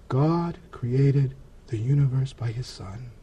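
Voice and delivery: slow, hushed quote; retold solemn pronouncement. Friend on the phone telling him of God, solemn hush. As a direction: say it softly and slowly, with weight.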